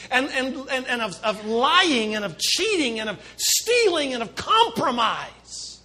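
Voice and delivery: loud, high, slow preaching; full-force preaching. Acted. Pastor preaching to congregation, loud and slow. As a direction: drive each phrase home slowly.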